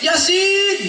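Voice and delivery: mock-startled exclamation; startled cry. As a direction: blurt Yasin! as if suddenly startled. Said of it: "ini nek kaget, muni ngene".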